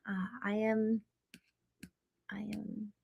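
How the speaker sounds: very quiet, nearly whispered mumble; distracted trailing off. Real, not acted. Host reading chat loses her thread, distracted and barely audible. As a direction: trail away into a whisper, attention elsewhere. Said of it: "sorry, I got distracted".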